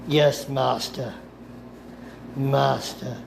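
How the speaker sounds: usual speaking voice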